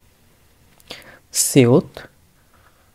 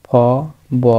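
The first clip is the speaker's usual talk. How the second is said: normal talking voice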